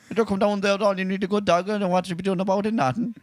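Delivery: lightly done thick Kerry brogue; half-mumbled Kerry country man's talk; Kerry farmer accent. Retold or imitated. Imitating the Kerry man from RTE, lightly voiced Kerry accent. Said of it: "the buck on R T E".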